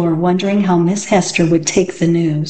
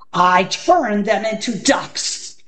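bellowed; slower than usual. Acted. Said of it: "bellowed Gasazi".

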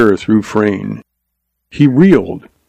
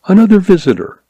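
high, lilting announcement; announcing nurse; 9 semitones higher, faster than usual. A narrator's reading. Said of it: "she announced".

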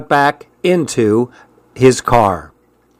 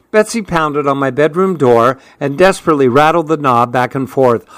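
plain reading voice

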